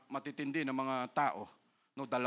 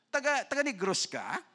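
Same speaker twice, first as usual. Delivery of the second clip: teacher's teasing question, pitched up; mock teacher; 8 semitones higher than usual. Teacher teases the student, high mock question. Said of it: "sabi ng maestra".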